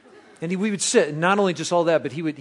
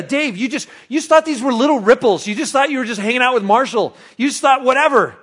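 excited; 11 semitones higher, 9 dB louder than usual. Pretend. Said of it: "I need to call Dave".

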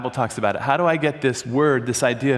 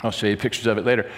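ordinary delivery

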